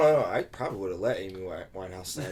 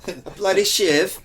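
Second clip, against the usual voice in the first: loud, higher mock rough English accent; menacing English accent; 9 semitones higher, 8 dB louder than usual. Joking about an English girl who'd shank him, loud mock accent. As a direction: snarl it like a knife-wielding English thug, loud and high. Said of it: "English accent that sounds like she's gonna shank me".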